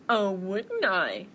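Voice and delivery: slow, loud bear threat; menacing bear. Acted. Bear threatening to eat mouse, taunting. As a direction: draw it out as a taunt.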